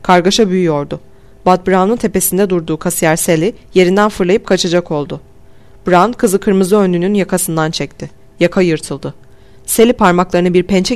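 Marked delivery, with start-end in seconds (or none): none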